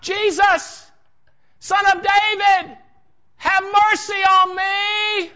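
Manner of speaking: high, drawn-out shout; crying out. Acted. Blind beggar cries out to Jesus as he passes, loud pleading shout. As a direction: call out slowly at full voice, pleading from afar. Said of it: "He cried out".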